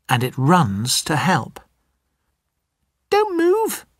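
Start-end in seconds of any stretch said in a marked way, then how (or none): 3.1-3.9 very high, tiny mouse voice; squeaky mouse. Acted. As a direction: squeak it like a scared little mouse. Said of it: "says the mouse".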